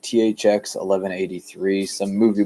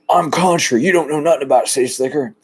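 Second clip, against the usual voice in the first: higher, fast, boastful country twang; country guy mocking a city slicker, boastful and fast; 6 semitones higher than usual. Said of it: "how many country people, it's like".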